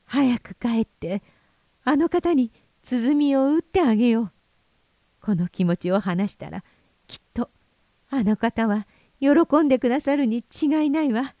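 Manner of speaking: put-on high, bright young woman's voice; bursting with new happiness; joyful. A narrator's reading. おるいが喜びにあふれて. (喜びにあふれた若い娘の声)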